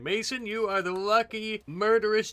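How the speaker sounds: normal talking voice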